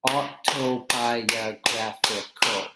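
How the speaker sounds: autobiographical drawn out slowly beat by beat; slow syllable clapping. Shown as a demonstration. Teacher claps out autobiographical slowly for students. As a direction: break autobiographical into slow, separate beats. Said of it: "clapping syllables demo".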